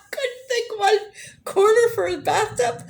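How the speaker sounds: high-pitched laughing fit, words squeezed out between laughs; helpless laughter. Real, not acted. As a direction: laugh until it nearly turns to tears. Cracks up at her own mix-up.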